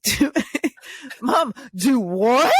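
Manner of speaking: high, slow, laughing through it; cracking up while quoting her son; mock teen voice broken by laughter; laughing quote. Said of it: "And Quest goes".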